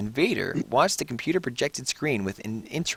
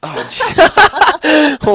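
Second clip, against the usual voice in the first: laughing; 6 dB louder than usual. Real, not acted. Reacting to an animated gif.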